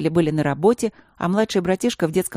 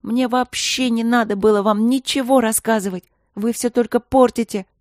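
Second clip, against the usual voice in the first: higher and louder, exasperated; angry outburst; 5 semitones higher, 4 dB louder than usual. A narrator's reading. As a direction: snap at them in frustration. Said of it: "Майка в сердцах воскликнула".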